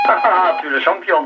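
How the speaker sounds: usual speaking voice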